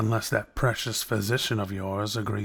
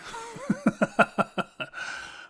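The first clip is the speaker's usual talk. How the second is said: breathy knowing laugh; amused laugh; 11 semitones higher than usual. Acted. Villain laughing at the listener's worry, amused.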